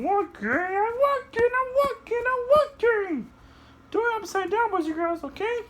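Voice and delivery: soar up into a tiny high voice; high puppet voice. Acted. Puppet shows kids how to fly, very high voice.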